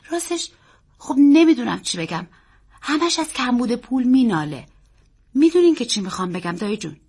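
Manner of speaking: put-on high, hesitant child's voice; troubled boy. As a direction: search for words as a boy confiding in an uncle. Boy struggles to explain.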